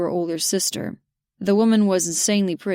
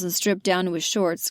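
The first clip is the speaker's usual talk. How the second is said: usual speaking voice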